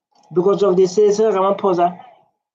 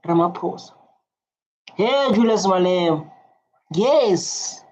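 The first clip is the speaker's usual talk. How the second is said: ordinary delivery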